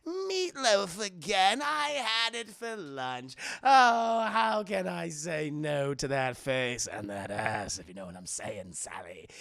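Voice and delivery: hammed-up doting cartoon villain; Joker. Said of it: "And he's like".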